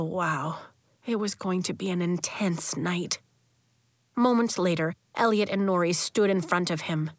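ordinary delivery

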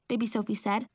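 usual speaking voice

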